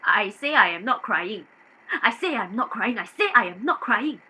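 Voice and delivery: firm repeated insistence on say; stubborn denial; insisting. Acted. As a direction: stress say, keep pushing back.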